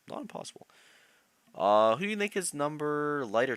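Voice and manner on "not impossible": whispered to himself. Real, not acted. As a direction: breathe it out as a whisper.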